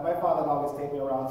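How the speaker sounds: ordinary delivery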